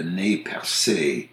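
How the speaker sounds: Nez Percés as nay pair-SAY; French pronunciation. Shown as a demonstration. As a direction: say Nez Percés with silent Z and final "ay". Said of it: "The French, who first encountered them, called them".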